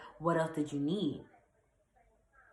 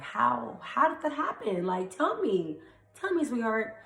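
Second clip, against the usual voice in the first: sarcastic; 7 semitones higher than usual. Real, not acted. Mocking the ex's claim.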